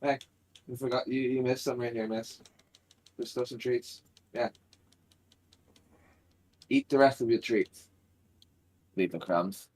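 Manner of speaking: soft pet talk. Real, not acted. Owner softly coaxing the dog to eat the treats. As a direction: coax a pet to finish its food.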